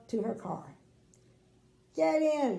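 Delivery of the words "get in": high, loud command; brisk order. Acted. Little Miss Sunshine orders the king, high and loud. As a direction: order him in cheerily. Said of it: "she said".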